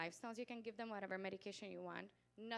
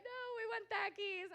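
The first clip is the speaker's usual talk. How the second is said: high, louder pleading whine; mock protest; 12 semitones higher, 5 dB louder than usual. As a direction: imitate kids begging for their snack. Voices families protesting the snack ban.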